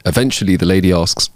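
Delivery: ordinary talk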